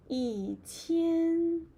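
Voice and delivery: 一 falling, 千 held high and level. Shown as a demonstration. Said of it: "E change to a falling tone".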